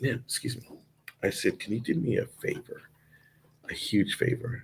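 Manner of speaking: hushed, breathy whisper so the patient can't hear; whispered request. Retold or imitated. Comedian whispers a prank request to the hospital janitor. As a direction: whisper it, keeping it secret from the man inside.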